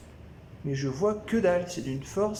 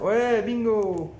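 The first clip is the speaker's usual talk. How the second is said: drawn-out, louder cheer of triumph; triumphant bingo; 4 semitones higher, 5 dB louder, much slower than usual. Real, not acted. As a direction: stretch out the win, loud and slow. Finds the light, cheering. (bingo traîné et triomphant)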